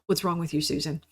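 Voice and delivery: ordinary talk, nothing marked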